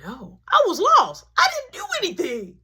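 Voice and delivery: high, loud whining denial; high whiny kid denial; whiny son. Retold or imitated. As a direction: plead innocence like a kid.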